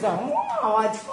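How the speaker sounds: very high sarcastic squeal; mock cheer. Teacher faking excitement about her new class, high. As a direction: chirp it with fake glee.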